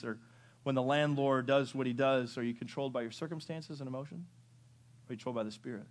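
ordinary talk, nothing marked

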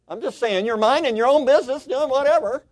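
high, sing-out insistence; high-pitched protest. Real, not acted. Playful defensive restating of scenario.

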